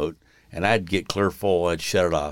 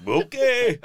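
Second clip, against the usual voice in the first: mock okay; 3 dB louder than usual. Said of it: "It's like".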